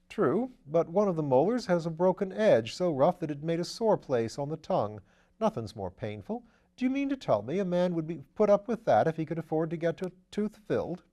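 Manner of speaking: lightly voiced upper-class gent; airy, amused aristocrat; breezy gentleman voice. A narrator's reading. Wimsey's voice in the reading.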